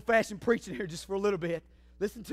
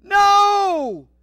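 high, loud scream of no; girl's scream; 10 semitones higher, 13 dB louder than usual. Retold or imitated. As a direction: cry out in panic. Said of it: "the girl screams".

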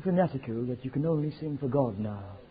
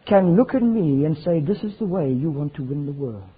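indignant shout; 4 semitones higher, 10 dB louder than usual. Retold or imitated. Preacher retelling how he rebuked the organizer, shouting. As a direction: thunder it at the backslidden organizer.

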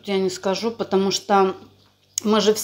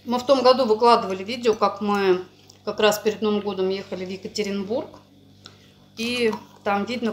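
eating between words; talking while chewing. Real, not acted. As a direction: mouth partly full of food. Seen 1: Both people eat dumplings throughout; the speaker is likely the woman.

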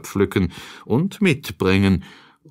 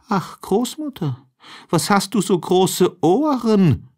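put-on much higher young girl, wondering; innocent girl; 10 semitones higher than usual. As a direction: ask in wide-eyed wonder. Rotkäppchen questions grandmother.

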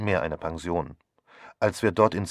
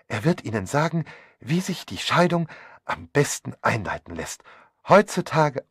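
high, fussy comforting that gets busier and louder; gushing, nosy hostess; 4 semitones higher, 4 dB louder than usual. Acted. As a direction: fuss over her effusively. (betulich tröstend, immer aufgeregter)

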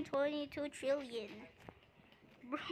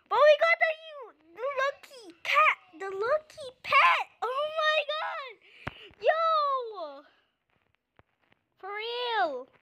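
high, loud thrilled shouts; real delighted yelling, much higher and louder; excited screaming; 10 semitones higher, 8 dB louder than usual. Real, not acted. Gamer shouting to viewers about the lucky pet, thrilled screaming. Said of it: "sorry guys if I'm screaming too much".

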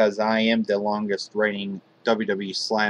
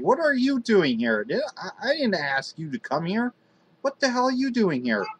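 high, startled pretend shock; shocked outburst; 10 semitones higher than usual. Show host shocked as Homicide crashes the pre-show, high and startled. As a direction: squeal it like the intruder caught you off guard.

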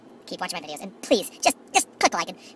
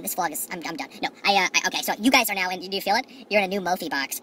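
usual speaking voice

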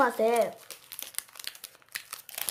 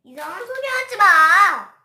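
mock scolding; 6 semitones higher, 11 dB louder than usual. Scolding partner's odd remark.

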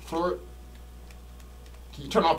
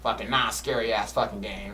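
usual speaking voice

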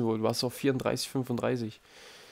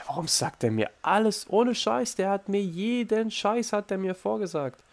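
much higher, slowed, exaggerated disbelief; incredulous rant; 9 semitones higher than usual. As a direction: act out how absurd the prompting was. Empört, dass er alles vorsagt. (viel höher und gedehnt, fassungslos empört)

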